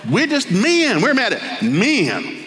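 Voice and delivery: lightly mimicked protester, pitched high; mock complaint in a high, drawn-out voice; hammed-up angry protester. Women protesters explaining their anger at men, mock indignation.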